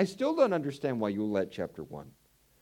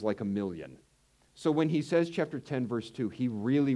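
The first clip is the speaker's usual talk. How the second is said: ordinary delivery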